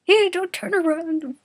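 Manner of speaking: high, loud and slow; urgent warning. Acted. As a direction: call out to the driver in alarm. Warning the driver.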